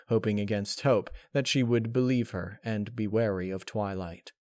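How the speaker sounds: ordinary delivery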